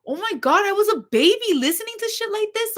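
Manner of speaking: recoiling at her younger self in a high voice; mock horrified exclaim. Retelling rereading sad lyrics, voice jumps high in mock horror. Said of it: "and it's like".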